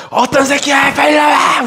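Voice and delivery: high, loud furious roar at the stall door; an angry department head pounding on the door and yelling; enraged shout. Retold or imitated. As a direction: scream for the culprit to come out. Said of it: "요분이 열받아가지고".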